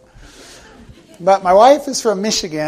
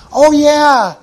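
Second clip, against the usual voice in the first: mock surprised accusation; 9 semitones higher, 7 dB louder than usual. Relative accusing Peter, retold.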